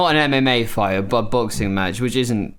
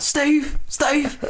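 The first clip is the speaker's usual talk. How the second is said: high mock yell; imitated festival shout. Imitating festival-goers with melting faces, high mock shout. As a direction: mimic someone still managing a high-pitched holler. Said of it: "They're still managing to shout".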